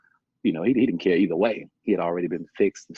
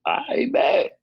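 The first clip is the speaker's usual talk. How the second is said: sarcastic reply; 5 dB louder than usual. Retold or imitated. Sarcastic retort voiced in story.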